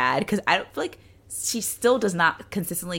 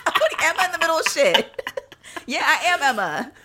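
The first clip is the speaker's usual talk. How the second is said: laughing mock confession; 6 semitones higher than usual.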